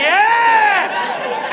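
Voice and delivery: high, loud delighted Yeah!; excited shout. Retold or imitated. Woman passes the age test, shouting with delight. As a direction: shout it like you've been waiting for this. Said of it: "she'll say".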